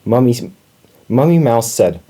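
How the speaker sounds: plain reading voice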